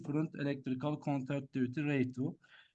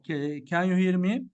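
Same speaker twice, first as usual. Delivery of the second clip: louder, calling into the mic; speaking up; 3 semitones higher, 7 dB louder than usual. Real, not acted. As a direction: push the voice out so they hear. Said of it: "now much better".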